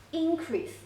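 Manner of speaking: Increase with IN high, then falling; stress on first syllable. Shown as a demonstration. Teacher showing students the noun Increase, stressed up front. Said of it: "the way you read it is different".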